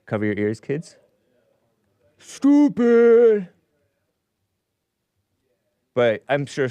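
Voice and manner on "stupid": loud, high snapped insult; sudden shouted jab; mock outburst. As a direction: blurt it out like a scolding. Mocking outburst after warning kids.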